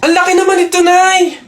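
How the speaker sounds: whiny complaint. Acted. Complaining about big stick.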